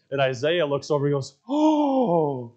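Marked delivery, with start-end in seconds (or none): 1.5-2.5 high, drawn-out "ohhh"; imitated "oh". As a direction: imitate a kid's exaggerated high "oh". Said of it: "like that to this kid".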